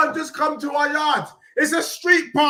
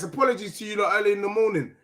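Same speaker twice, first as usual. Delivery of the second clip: normal talking voice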